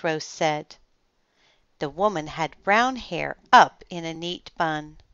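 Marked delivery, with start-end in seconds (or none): none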